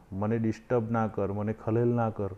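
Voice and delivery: usual speaking voice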